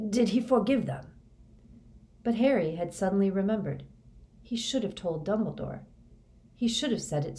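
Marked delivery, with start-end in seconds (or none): none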